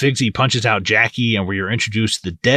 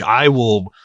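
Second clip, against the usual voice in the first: ordinary delivery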